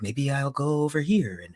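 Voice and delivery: a light mimicry of a wrestler's dreamy, sing-song promo; Kenny Omega impression. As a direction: mimic a drifting, rhythmic promo voice. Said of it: "he talks like this".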